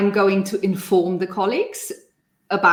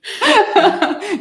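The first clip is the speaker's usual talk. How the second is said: high burst of laughter; 14 semitones higher, 7 dB louder than usual. Real, not acted.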